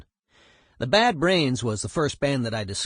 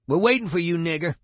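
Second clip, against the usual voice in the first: retold taunting shout; 6 semitones higher, 4 dB louder than usual. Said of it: "I hear them outside shouting".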